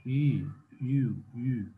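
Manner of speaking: यू with the tongue held back. Shown as a demonstration. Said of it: "in a back position". (यू, ज़बान पीछे खींच कर)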